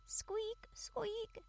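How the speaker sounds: high squeaky mouse squeak. Acted. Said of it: "said a little mouse".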